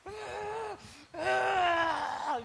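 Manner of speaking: high crying wail, voice breaking; imitated crying wail. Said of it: "ganyan ako na ganyan". (ginagayang iyak na pahiyaw, mataas)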